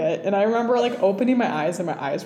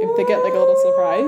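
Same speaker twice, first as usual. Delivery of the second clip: much higher, louder squeal; excited siren; 7 dB louder than usual. Real, not acted. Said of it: "that was my siren for excitement".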